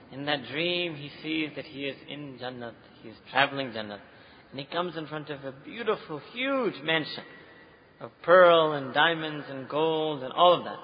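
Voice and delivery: ordinary delivery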